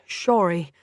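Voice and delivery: "sorry" said as "shorry", with a sh; "sorry" like a drunken Sean Connery, shushed s; slurred "shorry". A narrator's reading. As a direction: slur the s of "sorry" into sh. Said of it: "It came out as".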